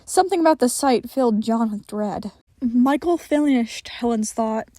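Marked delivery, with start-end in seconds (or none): none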